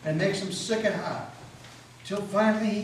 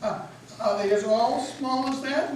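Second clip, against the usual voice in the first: hoarse; 7 semitones higher, slower than usual. A narrator's reading. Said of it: "he asked hoarsely".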